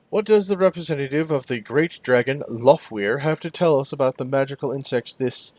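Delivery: usual speaking voice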